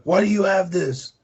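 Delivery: high-pitched mock indignant question; mock flipping out. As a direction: imitate an outraged husband demanding answers. Acting out husband flipping out.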